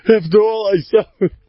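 high, hammed-up aggrieved complaint; mock wounded protest. Repeats the wounded husband mockingly.

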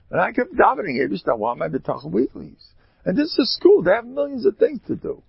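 excited preaching. Acted. Preaching story at full force.

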